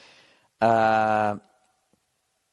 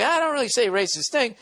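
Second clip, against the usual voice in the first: high-pitched, exaggerated protest of innocence; same claim pitched up high, as a put-on; mock alternative reading; 7 semitones higher than usual. Host guesses how the letter writer meant it, high mock reading. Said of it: "Or he's saying, like".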